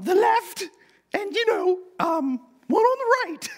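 very high put-on falsetto of a pushy mum; Monty Python mother. Pushy mother wheedling Jesus for a favor, hammed-up high Monty Python falsetto. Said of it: "a terrible Monty Python impersonation".

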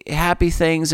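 ordinary talk

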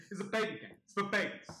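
loud, high indignant protest; reenacting a gamer's sore-loser outburst; mock tantrum, voice pushed up; retold angry rant. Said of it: "I was so mad".

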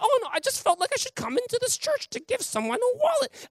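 put-on light, high old lady reply. Old lady explaining why she came in, high. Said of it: "I was like".

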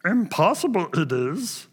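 a quick playful hint of Yoda. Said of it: "That was my Yoda".

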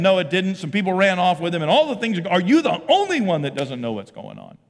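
loud, high-pitched sarcastic rant; sarcastic incredulity. Acted. Cleopas to the stranger who seems not to know, sarcastic. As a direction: pile it on, scornfully incredulous. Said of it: "there's a lot of sarcasm in that question".

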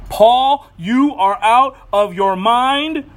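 a booming shout across the courtroom, slowed; loud accusation. Acted. Festus bellows at Paul in court, loud and slow. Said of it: "said with a loud voice".

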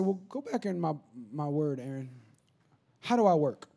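low, very soft, unhurried reply; hushed low counsel with pauses; God answering. Retold or imitated. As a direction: speak as God, gently correcting. Voicing God's quiet reply.

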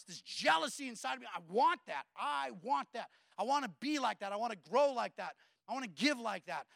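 ordinary talk, nothing marked

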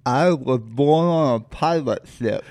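slow, loud, tongue held down; holding tongue with fingers. Shown as a demonstration. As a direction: say it while gripping the tongue. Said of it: "So hold your tongue and say".

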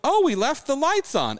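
mock her obliviousness; a lady oblivious to being in the wrong room; imitating the woman. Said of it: "She goes".

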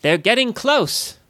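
high, slow, shrill little voice. A narrator's reading. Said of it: "echoed Netcher's shrill voice".

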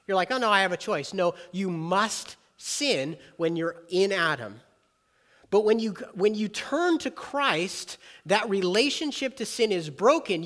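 loud, high, driving preacher voice; preaching. Acted. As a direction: preach it out to the whole room. Preaching at full force.

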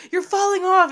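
high, loud panicked cry; the girls shrieking at him from the carriage; girls yelling warning. Retold or imitated. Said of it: "But they're like".

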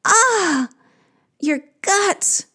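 very high, wailing sigh; echoed sigh. Acted. Creatures echoing the queen's pain, sighing high. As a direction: sigh back, pitched right up. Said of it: "They sigh".

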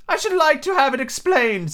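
high-pitched turtle voice; Mock Turtle figure. Mock Turtle asking to hear Alice, high character voice.

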